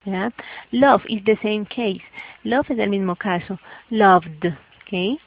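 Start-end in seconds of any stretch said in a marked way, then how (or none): 3.9-4.5 loud "Loved" ending in a released D. Shown as a demonstration. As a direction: model "Loved" as one syllable plus D. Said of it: "y le agrego la D". ("Loved" con D al final)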